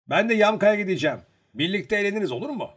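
high, loud, keen character voice; eager proposal. A narrator's reading. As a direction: pitch it up and suggest the outing eagerly. Eager proposal in acted dialogue.

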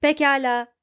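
loud, resounding robot reply; ringing, cracked bell. A narrator's reading. Marvin yielding after being yelled at, ringing out. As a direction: ring out like a hard-struck bell. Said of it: "sertçe vurulmuş büyük ve çatlak bir çan gibi çınlayarak".

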